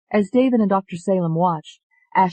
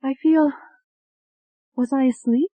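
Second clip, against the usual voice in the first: high, tentative waking voice; dazed young woman; 9 semitones higher than usual. A narrator's reading. Ashley wakes from hypnosis, high dazed voice. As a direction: ask it high and uncertain, with hesitant gaps.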